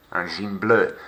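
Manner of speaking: normal talking voice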